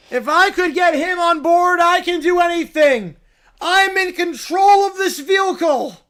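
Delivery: a hint of the cartoon bear, proud; the bear bragging at the wheel. Bear driving.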